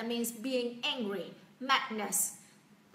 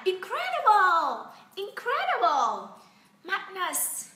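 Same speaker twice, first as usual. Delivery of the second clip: high-pitched, drawn-out exclamations; excited recap; 8 semitones higher, 6 dB louder, much slower than usual. Shown as a demonstration. Teacher reviewing acted-out words with learners, excited. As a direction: belt the words out with wild enthusiasm.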